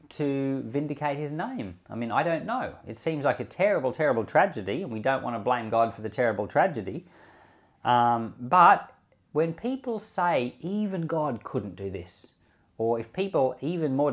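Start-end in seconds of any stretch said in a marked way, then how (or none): none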